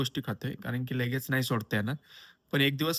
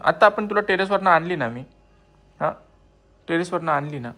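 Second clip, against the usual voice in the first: talking to a pet; 4 semitones higher, 7 dB louder than usual. Real, not acted. Coaxing dog.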